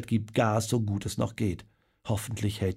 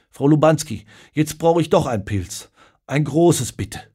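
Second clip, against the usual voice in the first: louder, higher call to the landlady; calling an order; 4 semitones higher, 6 dB louder than usual. A narrator's reading. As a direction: order urgently across the bar. Nervöser Pfarrer bestellt ein Bier.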